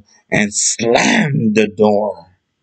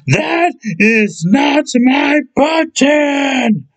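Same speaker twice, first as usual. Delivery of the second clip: shouting; 13 semitones higher, slower than usual. Acted. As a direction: bellow it out in frustration. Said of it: "shouted Toad".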